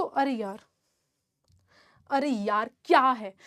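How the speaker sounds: low, soft weary grumble; quiet exasperation. Real, not acted. Teacher fed up with junk in the chat, quiet exasperation. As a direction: complain under your breath, pitch dropped.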